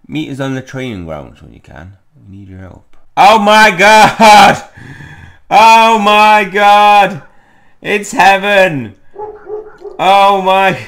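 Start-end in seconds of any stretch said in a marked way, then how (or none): 3.2-10.8 high, very loud, drawn-out squeal of joy; ecstatic screaming. Acted. Friend seeing a field full of creatures, ecstatic screaming. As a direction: shriek each phrase slowly in overblown rapture.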